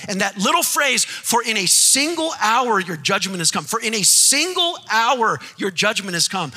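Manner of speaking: loud, high preaching cry; full-force preaching. Acted. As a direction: hammer the phrase home to the church.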